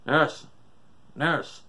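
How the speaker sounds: urgent shout for help; calling out. A narrator's reading. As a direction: call loudly for the nurse. Cal calling for the nurse.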